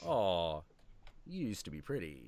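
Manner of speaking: soft, low pity for lost looks; pitying sigh. Retold or imitated. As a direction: murmur it with quiet disappointment. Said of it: "coke is just".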